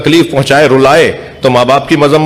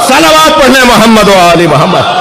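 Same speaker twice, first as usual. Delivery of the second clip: raised, ringing majlis climax; preaching at full force; 7 semitones higher than usual. Acted. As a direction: lift the crowd to its peak.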